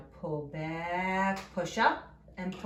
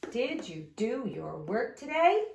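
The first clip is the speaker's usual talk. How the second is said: demonstrated question with TODAY rising; question rise on TODAY; 3 semitones higher than usual. Shown as a demonstration. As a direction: voice going up on TODAY. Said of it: "my voice is going up at the end".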